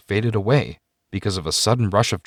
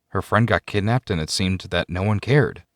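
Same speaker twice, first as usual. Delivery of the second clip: usual speaking voice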